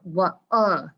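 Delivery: "What" split into "wha" and a punched "uh"; sounding out. Shown as a demonstration. Teacher finding an oddball vowel, "What" sounded out. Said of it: "I'm sounding it out".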